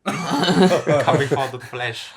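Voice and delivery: mock monster voice. Describing the leech crawling into his eye, slow mock menace. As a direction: draw it out like a horror-film creature.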